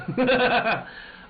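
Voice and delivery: real high burst of laughter. Real, not acted. Laughing after joking with friends.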